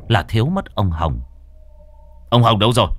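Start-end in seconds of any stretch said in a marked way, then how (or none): 2.3-2.9 raised, higher urgent call; urgent searching shout; calling out in alarm. Acted. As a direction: call out for a missing man. Searchers call for missing man.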